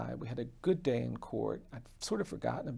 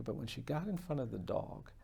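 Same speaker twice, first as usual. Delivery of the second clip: hushed whisper; 6 dB quieter than usual. Real, not acted. Telling the fear of the dog in a hush, whispering. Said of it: "you could see the fear".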